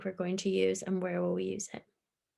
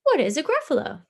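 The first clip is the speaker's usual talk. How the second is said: higher, louder puzzled question; curious owl asking; 7 semitones higher, 6 dB louder than usual. Acted. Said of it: "asked the owl".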